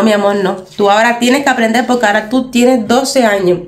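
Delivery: ordinary delivery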